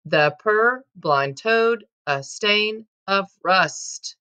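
very slow, beat by beat; reading in meter. Shown as a demonstration. Teacher showing students the iambic beat, very slow. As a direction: tap out each iamb as you read. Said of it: "And then if you noticed".

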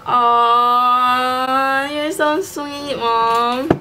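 sweet thank you. Real, not acted. Thanking her mom for the gift, sweetly gushing.

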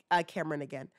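usual speaking voice

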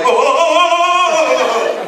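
high, loud laughing whoas; mock recoil whoa. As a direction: ride the recoil with rising whoas. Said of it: "you're just like".

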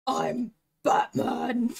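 drawn-out, loud manly superhero voice; mock manly Batman. Showing off a manly dad voice to viewers, hammed-up and slow. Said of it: "I have a really good manly voice".

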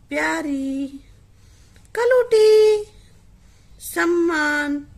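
each word said alone and loud, कलूटी called high; teacher dictation. Shown as a demonstration. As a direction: model the words clearly for pupils to copy. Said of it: "पहले सुनो मैं कैसे बोल रही हूँ". (हर शब्द अलग, ज़ोर से, साफ़ बोलना)

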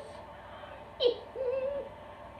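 soft, long squeal of delight; squeal, overcome with delight; high gleeful squeal. Real, not acted. Overjoyed by the gifts.

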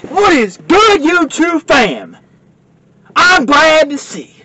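drawn-out high intro greeting; vlog intro. Acted. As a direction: sing out the channel greeting slowly.

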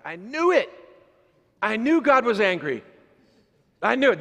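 high, loud triumphant I-knew-it; mock excited gotcha. Imagining the congregant gloating, high and loud. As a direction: crow like you caught God out. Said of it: "I'm like".

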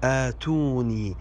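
"آتوني" slowly as aatuni; slow "آتوني" with a long opening a; long aa start. Shown as a demonstration. Teacher gives the correction, "آتوني" slowly as aatuni. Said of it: "والصحيح".

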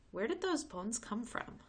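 ordinary delivery

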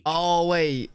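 ordinary delivery

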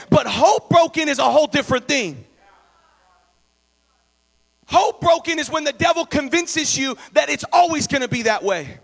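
loud, high preacher's cry; full-force preaching. Acted. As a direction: drive it home to the church. Preaching climax.

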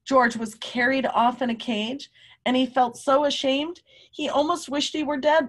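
plain reading voice

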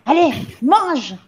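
high, forceful goading; shouted order. A narrator's reading. Le Gourdin pushing the gamin to eat, shouted. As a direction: shout at the child to get on with it. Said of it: "cria-t-elle".

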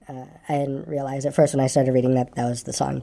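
ordinary delivery

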